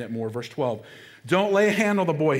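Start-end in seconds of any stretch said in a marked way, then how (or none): 1.3-2.4 loud, high cry to stop the blade; urgent command. Acted. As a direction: call out to halt the knife.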